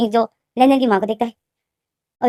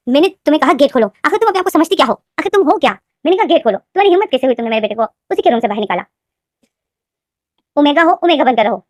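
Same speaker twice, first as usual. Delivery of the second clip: high, loud furious scolding; angry shouting; 4 semitones higher, 4 dB louder than usual. Acted. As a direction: tear into the daughter-in-law through the door. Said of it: "वो बहुत जोर से बोलती थी". (गुस्से में ऊँची आवाज़ में डाँटना)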